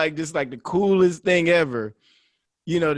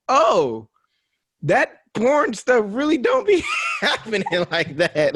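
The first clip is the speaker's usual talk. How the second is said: laughing realization; 7 semitones higher, 3 dB louder, slower than usual. Retold or imitated. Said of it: "I was like".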